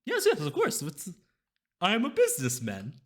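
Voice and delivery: high, loud, oily merchant; scheming seller, eager for gold; sly mysterious shopkeeper. Acted. Shopkeeper voice held.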